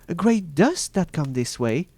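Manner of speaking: high-pitched voice of the watching sister; Sister Anne. A narrator's reading. Sister Anne reporting from the tower, high-voiced reply. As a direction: answer from above in a high voice.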